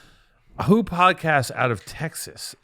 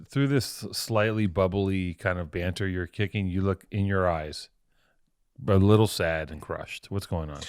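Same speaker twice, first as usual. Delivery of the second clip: slower, softer concern; 5 semitones lower, 5 dB quieter than usual. Real, not acted. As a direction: gently ask what's wrong. Concerned buddy checking in.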